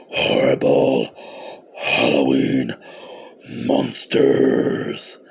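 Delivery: very low, slow creepy growl; deep, croaky Halloween menace; scary voice. Acted. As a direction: draw each word out like a spooky horror host. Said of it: "that scary voice".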